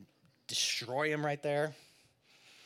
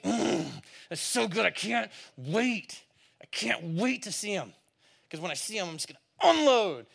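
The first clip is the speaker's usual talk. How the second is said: mock gleeful anticipation, higher on the payoff; gloating relish; 4 semitones higher than usual. Someone who loves being right itches to confront another, gloating. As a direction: savor it, stretching out the wait.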